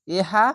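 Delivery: হা with air pushed out; breathy ha. Shown as a demonstration. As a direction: breathe the হা out audibly. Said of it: "অবশ্যই বাতাস বের করবেন".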